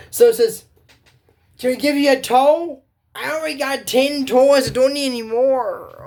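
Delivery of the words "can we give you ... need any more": high, louder character voices; voiced cartoon dialogue. Girls offering the pirate a tow, high cartoon voices. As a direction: play it like a kids' cartoon.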